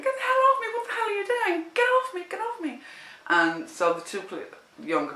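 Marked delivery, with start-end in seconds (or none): 0.0-2.8 high, loud shriek of protest; retold scream. Woman grabbed by the policewoman, shrieking high and loud. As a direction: fight her off, shrieking. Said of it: "I started screaming".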